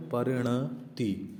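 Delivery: परिणति stretched very slowly, each syllable clear; word read out slowly. Shown as a demonstration. Teacher reading the word to students, very slow. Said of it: "क्या लिखा हुआ है".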